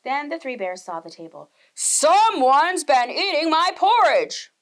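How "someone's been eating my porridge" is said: slow louder bear roar; Papa Bear finding his porridge gone, roaring slowly. A narrator's reading. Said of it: "roared Papa Bear".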